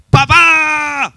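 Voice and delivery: high, loud cry out to the Father; loud shout. Retold or imitated. Broken man alone in his room calling God, shouting. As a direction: shout it with everything, full force. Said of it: "fuerte, pero fuerte".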